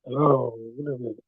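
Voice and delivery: faint, distant voice on a weak line; very low phone voice; barely audible. Real, not acted. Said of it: "Sir, your voice is very low".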